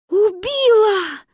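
high, loud drawn-out wail; alarmed child's cry. A narrator's reading. Girls panic as ambulance arrives, long high alarmed cry. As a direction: cry out the terrible news in panic. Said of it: "всполошились мы".

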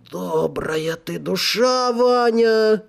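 slow, tipsy devil, fondly; drunk devil. Acted. Drunk devil praises soul.

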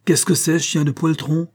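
scornful cry at the servant; a sneering master berating his groom; contemptuous outcry. A narrator's reading. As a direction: spit out the insult with disdain. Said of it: "s'écria l'entraîneur d'un ton de mépris".

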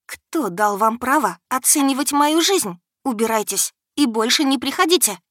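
loud, high angry dismissal; furious rebuke. Acted. Heroine throws the insulting dragon out, loud and furious. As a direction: throw him out in rage.

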